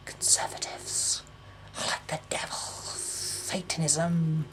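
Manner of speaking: hushed whisper of dread; conspiratorial whisper. Real, not acted. Vlogger darkly calling the Tories devils, hushed whisper. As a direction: whisper it like a dark secret. Dark aside about Conservatives.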